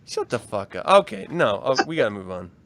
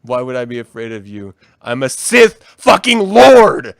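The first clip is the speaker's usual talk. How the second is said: Vader voice; 9 semitones lower, 4 dB louder than usual. Pretend. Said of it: "he would just be like".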